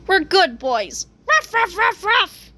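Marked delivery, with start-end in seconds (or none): 1.3-2.5 high-pitched mock barks; quick run of high woofs; dog barking. A narrator's reading. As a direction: bark like an eager dog. Characters barking in the story.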